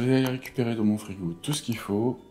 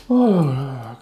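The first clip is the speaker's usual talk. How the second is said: delighted exclamation; 5 semitones higher, 6 dB louder, slower than usual. Real, not acted. Relishing the cold.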